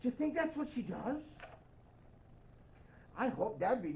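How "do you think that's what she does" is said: plain reading voice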